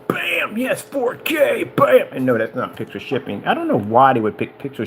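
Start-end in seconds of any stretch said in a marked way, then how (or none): 0.1-2.1 high-pitched "bam" spec boast; drawn-out, high comic hype; mock hype. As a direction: punch out each spec like a showman. Hyping the projector's printed specs.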